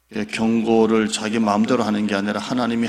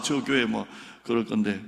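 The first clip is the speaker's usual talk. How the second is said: ordinary talk, nothing marked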